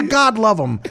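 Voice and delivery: normal talking voice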